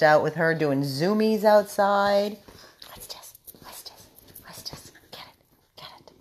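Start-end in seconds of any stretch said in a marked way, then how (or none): 2.9-6.1 hushed breathy whisper. Real, not acted. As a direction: whisper to tease the dog. Whispering to the dog.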